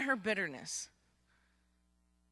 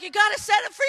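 parents urging; 9 semitones higher, 8 dB louder, faster than usual. Retold or imitated. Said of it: "the mom and dad were like".

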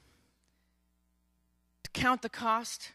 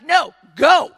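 loud, higher shout; forceful command; 5 semitones higher, 14 dB louder than usual. Acted. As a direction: push them away hard. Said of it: "And she's like".

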